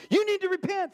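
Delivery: high, insistent mock warning; pushy evangelizing. Zealous believer telling a stranger to repent, pushy mock urgency. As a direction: confront them with exaggerated urgency.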